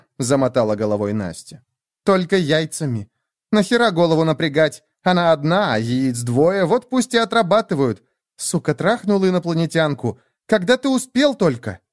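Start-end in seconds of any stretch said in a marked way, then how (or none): 2.0-11.8 put-on higher-pitched, indignant young woman; scornful Nastya. Nastya mocks Lev over the alien, scornful. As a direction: jeer at him in a raised pitch.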